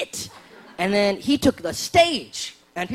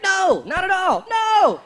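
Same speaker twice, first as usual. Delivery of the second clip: emphatic denial; 10 semitones higher, slower than usual. Retold or imitated. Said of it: "All you gotta do is be like".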